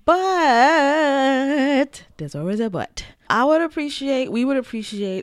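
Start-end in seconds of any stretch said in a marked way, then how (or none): 0.0-3.0 very slow, drawn out and louder; dramatic pause. Acted. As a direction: stretch it out for drama. Dramatic turn in the talk.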